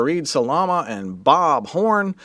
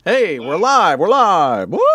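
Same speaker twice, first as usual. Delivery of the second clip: loud, high excited call-out; excited announcement; 5 semitones higher, 6 dB louder than usual. Real, not acted. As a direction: whoop that the stream is on air. Announcing the stream is live.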